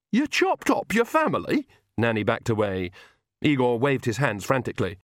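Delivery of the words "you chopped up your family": high-pitched shocked question; appalled. Acted. Visitor hears it was family, appalled and incredulous. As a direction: shoot the pitch up in disbelief.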